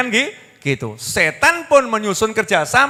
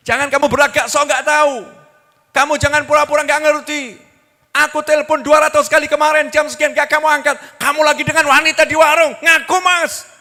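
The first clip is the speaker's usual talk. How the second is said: angry accusation; 8 semitones higher, 5 dB louder than usual. Acted. Wife accuses husband.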